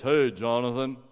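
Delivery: dad's big voice. Retold or imitated. Father greeting his son before surgery, big booming voice. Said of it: "instead of talking to me like this".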